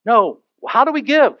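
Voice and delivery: normal talking voice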